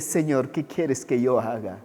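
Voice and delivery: low, soft, slow question to the Lord; humble prayer, acted. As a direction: ask it in quiet submission. (pregunta humilde, lenta y en voz baja)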